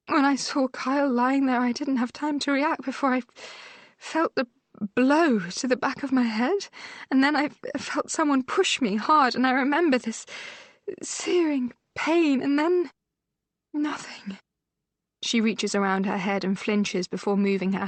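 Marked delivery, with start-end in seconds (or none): 0.1-12.9 higher, urgent voice reliving the blow; injured sister telling her sister about the attack, shaken; shaken sister recounting. A narrator's reading. 13.7-14.4 breathless whispered trailing off; a hushed whisper as the memory goes blank; faint whisper. Acted.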